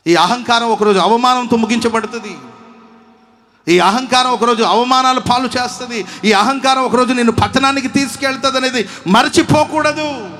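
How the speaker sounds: loud, high, ringing sermon warning; preaching at full force. Acted. Preacher warning the congregation about pride, loud and high.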